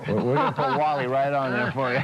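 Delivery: grin through it and laugh. Real, not acted. Dealer jokes about putting Wally's name on a car, laughing.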